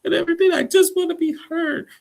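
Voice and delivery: very high, loud mock sobbing; mock crying wail. Shekinah crying out at Sarper in a hammed-up wail. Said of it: "she starts crying out".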